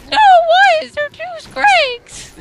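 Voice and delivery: very high, slow squeaky wail; high-pitched voice crack. Retold or imitated. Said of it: "I was like".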